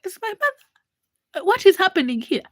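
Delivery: baffled and alarmed; stunned disbelief. Retold or imitated. Girl asking what her mother is doing, high and bewildered.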